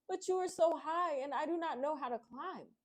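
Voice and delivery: a little frightened bear, calling up; Samson crying out. Acted. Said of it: "She cried out".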